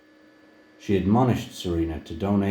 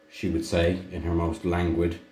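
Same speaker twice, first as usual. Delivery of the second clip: plain reading voice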